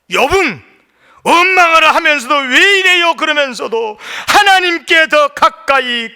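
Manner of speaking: high, loud, impassioned pulpit delivery; full-force preaching. Acted. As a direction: preach it forcefully at the top of your range.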